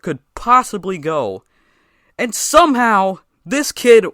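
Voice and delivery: exasperated rising shout. Retold or imitated. Frustrated about the faster kid.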